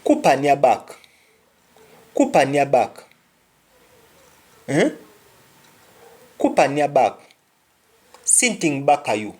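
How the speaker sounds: "Kupa near back" in a Jamaican Patois lilt, with a rising note of warning. Shown as a demonstration. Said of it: "You tell somebody".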